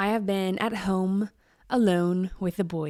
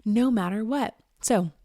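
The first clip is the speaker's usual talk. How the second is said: ordinary talk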